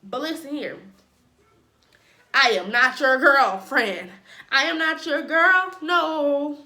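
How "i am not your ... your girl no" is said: loud, slow rebuke. Acted. Telling him off.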